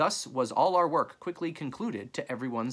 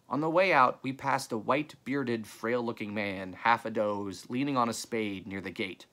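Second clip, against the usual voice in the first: plain reading voice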